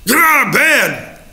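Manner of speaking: high, loud barking order; mock wife yelling her husband out of bed; wife's wake-up yell. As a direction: yell it like a grouchy wife at dawn. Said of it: "her sweet, melodious voice".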